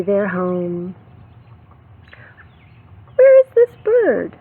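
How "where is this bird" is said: put-on high, loud, wondering question to the kids; excited question. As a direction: ask it with big storybook excitement.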